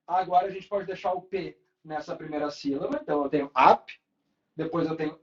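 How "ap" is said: ap said loud on its own, ending on p; isolated syllable. Shown as a demonstration. Teacher divides apneia for students, loud detached ap. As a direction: close ap on the p and stop. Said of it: "Como é que a gente separa".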